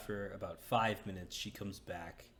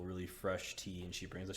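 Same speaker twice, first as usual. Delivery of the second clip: usual speaking voice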